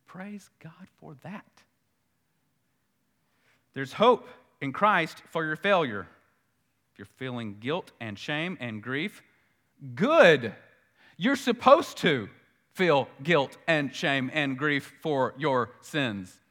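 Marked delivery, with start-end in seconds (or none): 0.1-1.5 whispered praise. Real, not acted. Preacher thanking God under his breath, whispered. 10.0-16.4 emphatic preaching. Acted. Preacher urging sinners to feel grief, loud.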